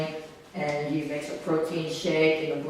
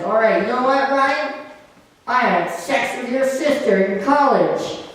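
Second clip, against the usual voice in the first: raised, higher-pitched angry taunt; mad; 6 semitones higher, 4 dB louder than usual. Acted. Prankster so mad he tries to hurt his housemate, angry. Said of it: "he gets so mad".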